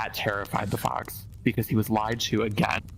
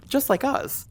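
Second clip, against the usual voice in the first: squeaky high. Acted. Squeaky punchline.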